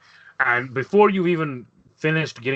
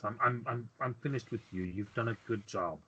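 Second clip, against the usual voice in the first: much softer, brushing someone off; muted dismissal; 14 dB quieter than usual. Acted. Chulari is finished with Alexander, softly dismissive. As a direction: wave them away under your voice.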